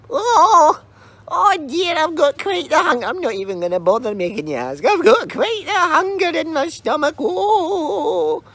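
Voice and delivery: put-on very high, whimpering old crone; wailing old lady.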